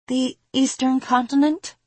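high, tentative character voice; timid deference. A narrator's reading. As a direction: answer the patriarch meekly, ending on a rise. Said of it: "her head still lowered".

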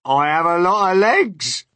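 high, loud boast; proud centipede. A narrator's reading. Centipede boasting to James of legs, proud. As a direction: crow it proudly, climbing in pitch. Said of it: "the centipede answered proudly".

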